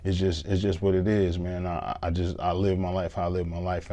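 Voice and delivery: usual speaking voice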